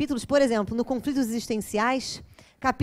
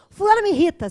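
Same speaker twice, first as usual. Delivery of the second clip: loud, higher, annoyed outburst; irritated complaint; 6 semitones higher, 8 dB louder than usual. Retold or imitated. Lecturer mimics an irritated person complaining, loud and annoyed. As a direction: snap it out like someone fed up. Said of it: "você não fala mais assim".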